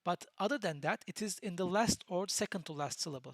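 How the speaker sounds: normal talking voice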